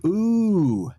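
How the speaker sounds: high, loud impressed ooh; be genuinely impressed. Real, not acted. Impressed by max level.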